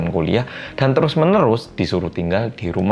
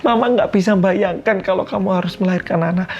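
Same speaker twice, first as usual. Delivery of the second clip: high, frantic mock yell of a desperate mother; 10 semitones higher than usual. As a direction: shriek it out, begging her to stop. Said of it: "Mamanya di situ udah teriak-teriak". (teriakan ibu yang panik, nada tinggi)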